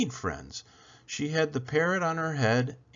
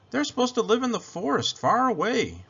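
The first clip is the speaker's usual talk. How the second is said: higher, lightly voiced young boy; a shy boy, pitched up; boy's voice; 10 semitones higher than usual. A narrator's reading. Boy answers Isabel about his box, higher boy voice.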